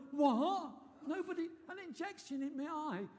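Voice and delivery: shocked. Retold or imitated. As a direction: blurt it out in disbelief. Shocked retold reaction.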